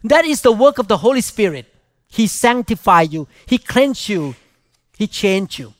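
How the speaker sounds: normal talking voice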